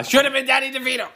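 high, quick burst of protest; ranting aside. Real, not acted. Podcaster slipping back into casting rant, high and worked up.

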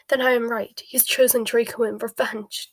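mildly choked with emotion. A narrator's reading. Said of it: "choked Narcissa".

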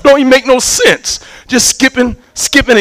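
usual speaking voice

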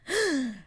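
high theatrical gasp; hammed-up sharp intake of breath; a dramatic gasp for effect; mock gasp. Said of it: "Dramatic pause".

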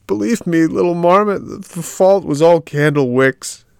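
high, loud, slow desperate plea; pleading puppet. A narrator's reading. Puppet to the marmot, high and loudly pleading. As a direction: beg to be believed.

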